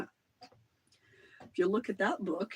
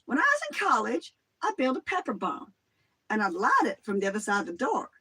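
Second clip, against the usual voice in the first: lightly voiced high storyteller; a hint of a giddy prankster; recounting the prank in his voice; imitating Joel; 4 semitones higher, faster than usual. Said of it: "Joel Basto, the Joker".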